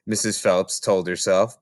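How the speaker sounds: ordinary delivery, nothing marked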